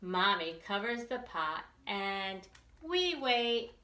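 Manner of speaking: plain reading voice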